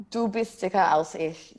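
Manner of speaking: put-on a touch of German accent on the phrase. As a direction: say it like a native German, lightly. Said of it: "a good German accent".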